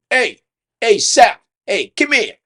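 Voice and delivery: high, loud imitated summons; calling out. Banquet guy calling the rookie over, loud and insistent. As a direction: holler someone over, punching each word.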